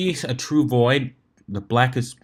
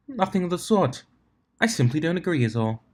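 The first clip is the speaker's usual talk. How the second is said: lightly voiced refined lady, higher; a hint of a prim unicorn, pitched up; Rarity's voice; 7 semitones higher than usual.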